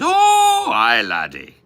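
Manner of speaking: put-on Irish accent. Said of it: "You're from Ireland?".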